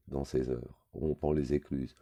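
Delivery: ordinary delivery, nothing marked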